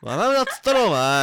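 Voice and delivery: loud. Real, not acted. Scolding laughing partner.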